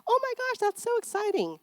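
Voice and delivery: high, thrilled reply; excited mirroring. Shown as a demonstration. Parent matching the child's joy with a high excited reply. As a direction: gush back with joy. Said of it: "mirror that excitement and joy".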